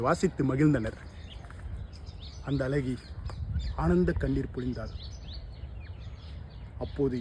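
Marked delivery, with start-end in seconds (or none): none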